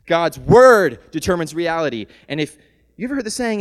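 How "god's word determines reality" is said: "word" hit hard and loud; louder restatement. Shown as a demonstration. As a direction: drive home "word" with force.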